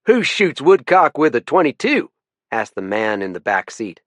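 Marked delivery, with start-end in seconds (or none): 0.1-2.1 put-on Texas accent. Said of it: "a thick Texas accent".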